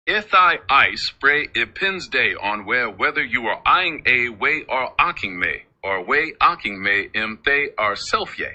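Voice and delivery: slow, deliberate Pig Latin. Shown as a demonstration. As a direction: recite it slowly in Pig Latin. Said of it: "can you tell me in Pig Latin".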